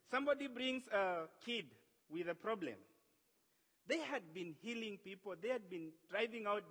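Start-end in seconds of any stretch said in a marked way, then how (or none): none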